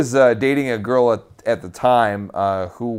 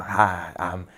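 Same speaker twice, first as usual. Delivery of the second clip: Southern cult leader voice; 7 dB quieter than usual. Shown as a demonstration. Actor demoing his audition voice for the cult leader, whispered Southern accent. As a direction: breathe it out in a creepy Southern accent, soft and secretive. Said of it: "to get a nice Southern accent like this".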